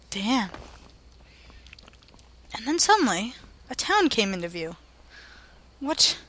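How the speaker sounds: ordinary talk, nothing marked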